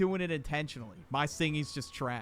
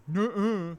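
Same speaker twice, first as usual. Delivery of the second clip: higher-pitched mimicked refusal; pitched-up mock contradiction; mock stubborn denial; 4 semitones higher, 4 dB louder than usual. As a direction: imitate someone flatly refusing to admit it. Imitating someone who stubbornly denies it.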